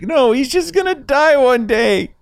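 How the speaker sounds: high imitated weepy wife; mock crying wife. Quoting his wife crying over the dog, high. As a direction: whimper it like the tearful wife.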